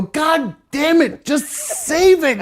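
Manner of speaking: slow, high, drawn-out mock despair; exasperated. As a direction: stretch the words out like you give up. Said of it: "And I go".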